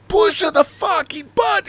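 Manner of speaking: sudden very high squeaky mimic; over-the-top actor. Mimicking the actor going over the top, very high squeak. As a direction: blow it out like the actor did.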